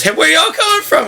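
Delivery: high-pitched retold cop voice; imitated cop. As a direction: ask it high, as the friendly cop in your story. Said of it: "He goes".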